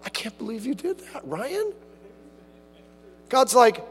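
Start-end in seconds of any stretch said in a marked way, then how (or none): 0.0-1.7 soft, scandalised mock gasp; a hint of scandalised disbelief; mock shock. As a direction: act out fake shock. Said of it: "God's not shocked like".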